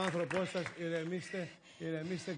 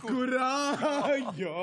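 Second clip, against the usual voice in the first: loud, high, long-drawn word of comfort; hammed-up condolence; 7 semitones higher, 6 dB louder, much slower than usual. Man consoling a grieving widow, hammed-up and drawn out. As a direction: stretch it out like an overblown condolence.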